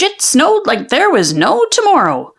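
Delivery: plain reading voice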